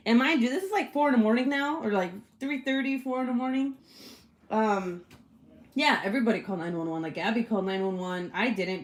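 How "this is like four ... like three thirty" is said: usual speaking voice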